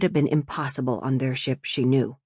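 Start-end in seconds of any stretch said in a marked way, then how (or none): none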